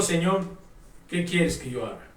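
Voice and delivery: low, soft, understated fearful plea; retold humble question, voice dropping low and quiet; temeroso. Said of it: "dice que temblando y temeroso". (temeroso, en voz baja y grave)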